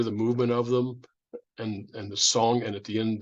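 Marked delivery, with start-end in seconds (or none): none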